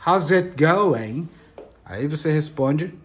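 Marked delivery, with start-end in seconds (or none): 0.1-1.3 slow teaching demo. Shown as a demonstration. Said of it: "Como está a sua vida?".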